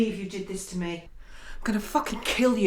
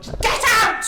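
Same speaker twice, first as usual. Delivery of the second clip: much higher, louder screaming order; furious scream; 12 semitones higher, 6 dB louder than usual. Acted. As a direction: scream him out of the house. Wife throws husband out.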